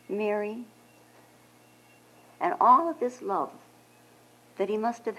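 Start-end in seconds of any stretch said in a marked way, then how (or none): 0.1-0.6 soft, tender name; Jesus quietly calls Mary by name. Acted. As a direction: say her name quietly, with love. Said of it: "very quietly, Jesus said to her".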